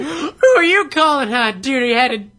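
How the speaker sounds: high, loud mock outrage; mock offended gasp. Friend fakes outrage at a playful insult, high and loud. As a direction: gasp and snap back in fake offense.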